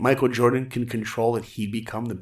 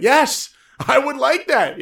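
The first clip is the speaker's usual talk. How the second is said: high, loud and slow with delight; thrilled; 13 semitones higher, 8 dB louder, slower than usual. Retold or imitated. Young artist accepting the Disney offer, thrilled. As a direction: burst out with overjoyed yes.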